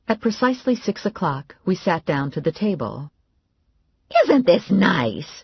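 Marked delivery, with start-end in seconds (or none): 4.2-5.3 Grandma voice. A narrator's reading. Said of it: "Grandma said".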